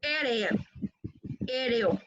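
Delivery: "aéreo" as "éreo", with an extra e; wrong aero. Shown as a demonstration. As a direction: show the faulty "aéreo" ending in -reo. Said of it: "No es aéreo".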